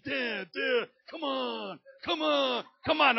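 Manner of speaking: high, loud pestering whine; building whiny kid shout; whining son. Retold or imitated. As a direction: beg and nag like a kid.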